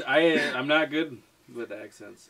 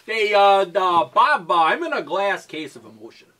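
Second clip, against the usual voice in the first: botched attempt at an accent, sounding Canadian; 6 semitones higher, 6 dB louder than usual. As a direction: try a loud, higher Canadian accent. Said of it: "That sounds like Canadian".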